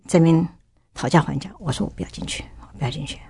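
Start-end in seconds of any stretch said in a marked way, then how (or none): 1.9-3.2 whispered, much softer, sobbing refusal; tearful whisper. Retold or imitated. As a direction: plead in a broken whisper through tears. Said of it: "我就一直哭". (哭着低声耳语)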